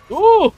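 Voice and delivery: very high, loud "uh"; startled whoop. Real, not acted. Player surprised by the boss's move, high startled whoop. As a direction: yelp at a sudden attack.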